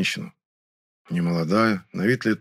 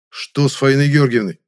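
louder, higher, urgent demand; too sharp; 4 semitones higher, 6 dB louder than usual. A narrator's reading. Narrator demands news of Faina Georgievna, too sharply. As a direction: snap the question out urgently. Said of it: "голос мой прозвучал слишком резко".

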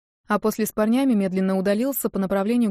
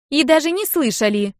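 loud, higher cheeky denial; Нинка sassing the old guard, loud and higher; cheeky retort; 7 semitones higher, 5 dB louder than usual. Acted. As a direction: sass the guard.

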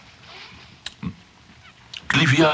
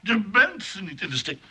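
mocking echo; 9 semitones higher than usual. Mocking her phrase.

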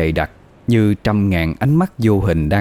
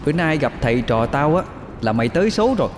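put-on high, defiant girl's voice; shrill but firm; 6 semitones higher, 3 dB quieter, faster than usual. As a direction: taunt the demon boldly. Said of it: "giọng the thé mà rắn rỏi". (giọng the thé mà rắn rỏi)

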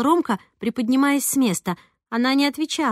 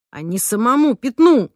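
louder outburst at her; exploding; 2 semitones higher, 6 dB louder than usual. A narrator's reading. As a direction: snap back in anger. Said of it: "взорвался Ромка". (взрываясь, громко)

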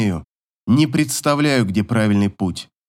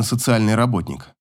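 plain reading voice

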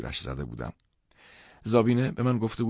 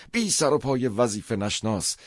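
louder, higher yelled insult; angry shout; 5 semitones higher, 5 dB louder than usual. Retold or imitated. Karl yelling after him on the stairs, angry shout. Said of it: "از پشت سرم فریاد زد".

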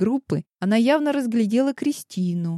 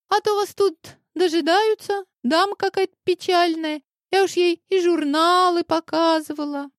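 put-on high, bright-eyed chatterbox girl; a bubbly teenage girl prattling on; chirpy young girl voice; 10 semitones higher than usual. A narrator's reading.

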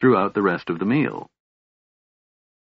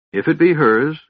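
weary ancient elf, higher; aged voice; 9 semitones higher, 5 dB louder than usual. A narrator's reading. Elf speaker answering the dwarf, aged and weary. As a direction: sound older than centuries. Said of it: "in a voice older than his hundreds of years".

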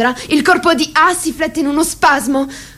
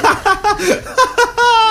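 belly laugh; 7 semitones higher than usual. Acted. Leader mocking his comrades, roaring with laughter. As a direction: laugh your head off at them. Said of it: "A rise a crepapelle".

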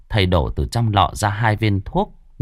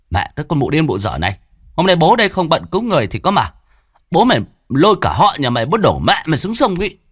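higher, louder, fast cursing; angry threats; 8 semitones higher, 3 dB louder, faster than usual. A narrator's reading. Sorcerer snaps threats at the screaming concubine, loud and fast. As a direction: bark threats back at her.

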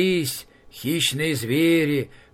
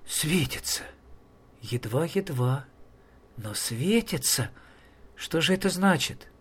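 hushed wonder; 6 dB quieter than usual. Acted. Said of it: "разом прекращая плакать".

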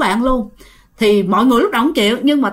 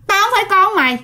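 possessed voice; 9 semitones higher than usual. Retold or imitated. Possessed girl rejecting her mother, high and harsh. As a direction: bark it as a stranger inside her.

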